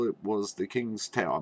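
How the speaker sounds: plain reading voice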